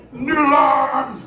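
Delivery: slow, loud drawn-out call to the crowd; crowd shout. Shown as a demonstration. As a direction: hype the kids up with a stretched yell. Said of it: "Noodle arms, everybody".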